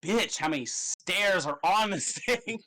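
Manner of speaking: lightly hammed-up griping at the stairs; a hint of a winded adventurer; mock exhausted climber. Players after the pyramid stairs, mock griping. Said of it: "you're like".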